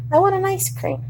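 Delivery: high, whiny little wish. Real, not acted. Hungry patient pleading.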